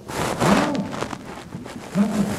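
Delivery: high, drawn-out innocent "no"; mock denial. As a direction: imitate a husband caught out and denying it. Retold husband's denial when caught.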